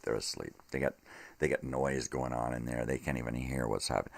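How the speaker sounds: ordinary talk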